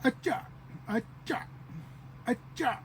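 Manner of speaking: "acha" high, in two split syllables; jesting mimicry. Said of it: "that was just mimicking".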